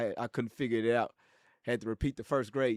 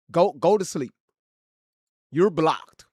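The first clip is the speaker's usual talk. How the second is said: louder, final dismissal; firm dismissal; 6 dB louder, slower than usual. Real, not acted. Streamer blocking a trolling chatter, loud and final. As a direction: shut the troll down.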